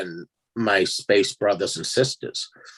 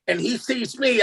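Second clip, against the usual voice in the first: ordinary delivery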